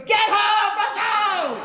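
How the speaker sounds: shouted high and loud out the window; mother yelling. Retold or imitated. Mother hollering out the window for her son to come home. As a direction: bellow it like a mother calling her kid home.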